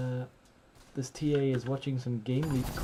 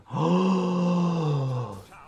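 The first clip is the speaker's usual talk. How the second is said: long loud gasp of awe; amazed gasp; 4 semitones higher, 6 dB louder than usual. Real, not acted. Reacting to great play.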